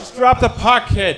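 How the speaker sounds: high, loud mock heckle; heckler's shout. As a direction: holler down at the ice like an impatient fan. Heckling crowd answers the announcer.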